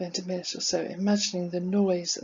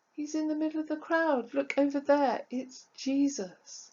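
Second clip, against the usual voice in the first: high, sustained call without much volume; son calling out; 6 semitones higher than usual. Acted. Son pointing out Jesus in the crowd, calling out. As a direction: call high across a distance, slowing at the end. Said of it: "shouting at me at the top of his voice".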